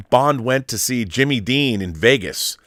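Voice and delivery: ordinary talk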